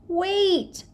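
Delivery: put-on high child's shout, up; little boy's high protest. A narrator's reading. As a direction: call out to stop them, high and urgent. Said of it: "Fudge shouted".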